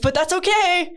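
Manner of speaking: high, breezy dismissal; airy, everything-is-fine tone; chirpy Glinda. Retold or imitated. Imitating Glinda's cheerful reassurance, high chirpy.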